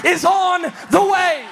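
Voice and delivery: loud rising preacher cadence; fiery sermon climax; preaching at full force. Acted. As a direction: preach it out to the congregation. Preaching build.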